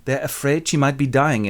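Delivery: usual speaking voice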